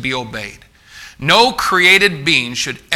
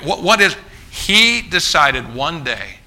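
ordinary talk

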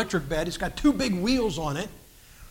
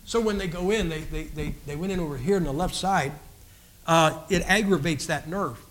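normal talking voice

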